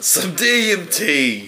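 high, slow mocking echo; mock stoner drawl. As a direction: drag it out like a goofy stoner. Mocking repeat of suggestion.

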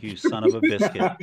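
high, loud mock outrage. Host to the friend who ate his bread, mock outraged yell. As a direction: bellow it like you've been robbed. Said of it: "And I'm like".

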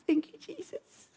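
high teary laugh and thanks; laugh-cry your gratitude; relieved tearful thanks; laughing through tears. Retold or imitated.

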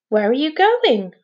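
anxious. A narrator's reading. Father asks his son where he's going, mildly anxious. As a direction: ask with a touch of worry. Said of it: "asked his father anxiously".

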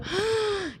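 jadeo agudo y corto de sorpresa; jadeo de susto. Retold or imitated. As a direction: ahoga un grito de susto. Reacción al chisme. (jadeo agudo de sorpresa)

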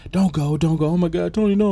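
high-pitched hammed-up pleading; mock crying wife. Wife begging her dying husband not to go, mock crying. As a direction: parody a wife wailing, milking it. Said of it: "her coming up and crying".

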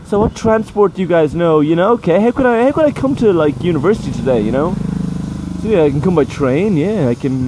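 high, loud, exaggerated classroom teacher; hammed-up cheery teacher quizzing the class. Teacher mocking herself quizzing adult students, hammed-up. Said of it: "I'm just like".

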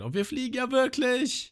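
high, stunned Lysop cry; mock shout. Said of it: "Und Lysop schreit". (hoher, fassungsloser Ausruf)

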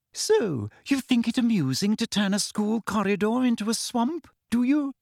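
mock her prey sweetly; Umbridge taunting. A narrator's reading. Umbridge taunting the cornered twins, high and sneering.

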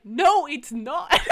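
loud, drawn-out denial; slow, exasperated contradiction; emphatic retort. Retold or imitated. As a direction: push back hard, half laughing. Acted retort to the correctors.

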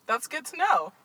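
California upspeak. Real, not acted. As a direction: catch yourself doing California upspeak. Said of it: "You go up on the end of everything".

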